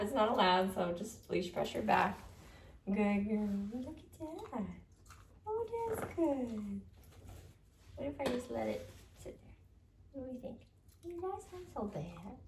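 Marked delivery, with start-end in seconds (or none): none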